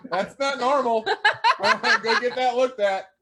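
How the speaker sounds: loud, high, through bursts of laughter; laughing hard. Real, not acted. Host teasing cousin over the box joke, laughing hard. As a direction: crack up while getting the words out.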